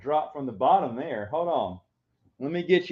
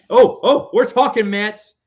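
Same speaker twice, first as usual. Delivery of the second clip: higher and louder, bursting with joy; excited dad shouting about the Mets; 7 semitones higher, 9 dB louder than usual. Real, not acted. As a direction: cheer it out to the camera.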